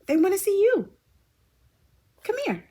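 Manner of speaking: high coaxing pet voice. Real, not acted. To Tootie the cat, high coaxing baby talk. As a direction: coax the cat in a high sing-song.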